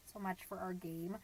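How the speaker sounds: soft, low letdown; deflated. Acted. Mom learning nobody is listening to her game, deflated. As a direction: let the excitement drain away.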